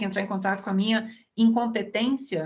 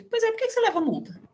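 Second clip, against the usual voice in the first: mock-incredulous question jumping high; high teasing "por que"; sudden high questioning; 13 semitones higher, faster than usual. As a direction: pitch it way up in disbelief. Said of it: "Eu falo".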